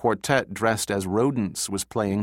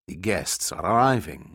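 lightly gravelly servant voice; a hint of gravel, kept low-key; 3 semitones lower than usual. A narrator's reading. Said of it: "in a voice like gravel sliding off a shovel".

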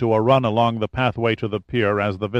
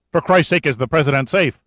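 urgent; 5 semitones higher, 4 dB louder than usual. A narrator's reading. Urgent demand for news.

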